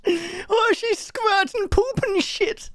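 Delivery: put-on high, slow comic German accent; mock German accent. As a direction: mimic a silly German accent, drawn out. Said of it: "what it is about this accent".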